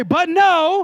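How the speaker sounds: loud, high sassy shout of "But no"; attitude shout. Shown as a demonstration. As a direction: shout it back with full attitude. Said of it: "some of y'all have better attitude".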